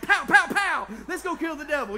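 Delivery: hammed-up gunfire shouts into a rallying cry; loud, high, over-the-top battle yell; screaming. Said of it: "I'm screaming".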